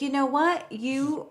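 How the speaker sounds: high, eager put-on therapist voice; mock therapist. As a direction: play the chirpy therapist with a big idea. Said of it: "And she's like".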